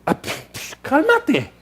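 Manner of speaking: breathless whispered puffing then a high "cálmate"; flustered people. Retold or imitated. Startled onlookers hushing the excited believer, puffing. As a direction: fan yourself and beg them to calm down. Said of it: "la gente está".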